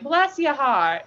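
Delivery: high, slow Southern drawl; Southern accent. Shown as a demonstration. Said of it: "say something real sound".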